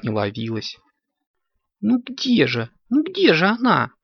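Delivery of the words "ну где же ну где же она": high-pitched, pleading impatience; impatient kitten. A narrator's reading. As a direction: keep asking high and antsy, like a child. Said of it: "нетерпеливо приговаривал Коржик".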